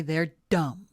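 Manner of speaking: usual speaking voice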